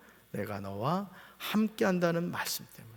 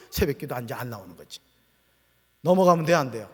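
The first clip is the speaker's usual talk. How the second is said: normal talking voice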